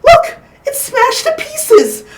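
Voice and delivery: put-on crying child. Child crying over broken chair.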